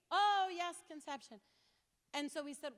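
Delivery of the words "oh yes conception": high then dropping meek agreement; mock eager agreement. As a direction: mimic his quick meek yes. Petitioner's agreement voiced.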